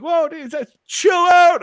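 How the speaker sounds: high, loud cry to calm down; retold shout. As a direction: cut through the yelling, voice leaping up. Cutting off yelling adults, retold.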